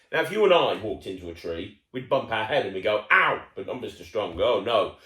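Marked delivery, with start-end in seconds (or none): 3.1-3.4 mock ow. Imitating bumping into tree.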